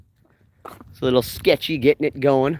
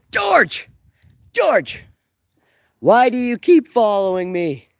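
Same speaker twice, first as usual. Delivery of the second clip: shouting after someone; 10 semitones higher, 5 dB louder, slower than usual. Retold or imitated. Acting Marty chasing George.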